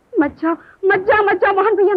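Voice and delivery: high, crying entreaty; tearful pleading. Acted. Woman begging brother-in-law not to leave, tearful. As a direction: beg him through tears.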